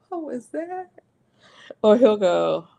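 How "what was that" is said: startled, soft and high. Retold or imitated.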